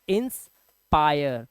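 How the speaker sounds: Inspire split slowly as "ins ... paayer"; slow breakdown. Shown as a demonstration. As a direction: pause after "ins" in inspire, then draw out the rest. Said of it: "तो आया का आवाज आ रहा है".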